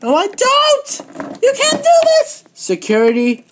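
high, loud, frantic screams at full force; hysterical screaming. Acted. Champion shrieks in a panic as her belt is taken. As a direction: shriek like a tantrum that won't stop.

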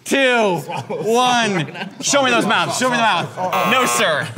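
high, loud excited countdown. Real, not acted. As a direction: build the hype to the buzzer. Host counts down the final seconds.

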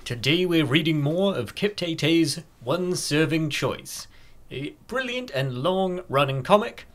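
normal talking voice

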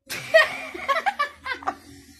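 loud, very high cackle; high squealing laugh. Real, not acted. Mother cracking up on camera, high squealing laugh. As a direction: burst into helpless squealing laughter.

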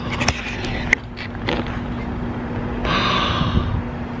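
breathy gasp of delight; sharp gasp of awe; excited gasp. Real, not acted. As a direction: gasp at the food. Sees the food.

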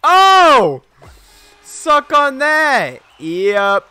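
high, loud, slow gloating cry; triumphant yell. Real, not acted. Streamer celebrates a hit, high slow gloating yell. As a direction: scream victory in their face. Winning moment in game.

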